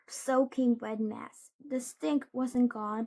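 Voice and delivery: usual speaking voice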